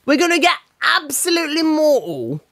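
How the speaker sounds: high-pitched, put-on Geordie accent. Shown as a demonstration. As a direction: do your best Geordie party voice, pitched well up. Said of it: "give us a Geordie accent".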